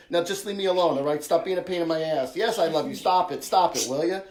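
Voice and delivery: loud, mock-annoyed scolding of mom; exasperated daughter. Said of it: "almost angry with you".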